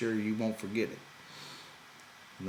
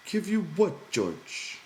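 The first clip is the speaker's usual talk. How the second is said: much higher, wide-eyed mock innocent Lenny; feigned innocence; 12 semitones higher, 5 dB louder, slower than usual. A narrator's reading. Lenny hiding the mouse from George, high fake innocence. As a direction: play dumb, all innocence.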